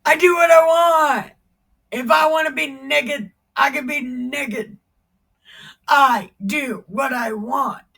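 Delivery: loud, defiant king; defiant shout. Acted. David answering his wife, defiant shout. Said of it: "And David said".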